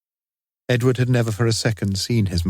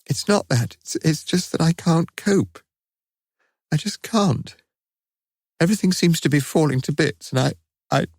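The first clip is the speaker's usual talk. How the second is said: high, breaking, close to sobbing; a mother who can no longer cope; tearful, despairing; 9 semitones higher than usual. A narrator's reading. Mother confesses to her son through tears that she can't cope. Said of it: "the tears were rolling freely again".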